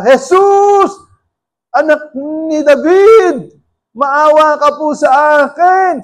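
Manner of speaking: high, slow desperate cry for help; shouting for mercy. Retold or imitated. Blind Bartimaeus shouting to Jesus, slow desperate cry. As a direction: blind beggar yelling to be heard over the crowd. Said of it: "Tumisigaw siya".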